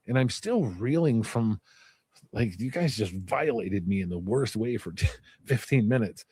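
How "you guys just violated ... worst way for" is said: ordinary talk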